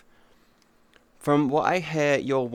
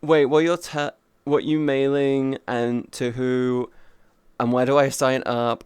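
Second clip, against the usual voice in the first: ordinary talk, nothing marked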